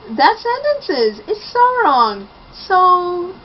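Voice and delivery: high, loud, drawn-out exasperation; appalled. Real, not acted. Reviewer ranting at how bad that sentence is, appalled. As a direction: stretch it out in high-pitched dismay.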